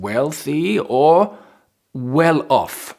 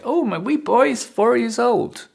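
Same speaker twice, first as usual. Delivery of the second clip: example answer; 10 semitones higher than usual. Acted. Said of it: "So, for example".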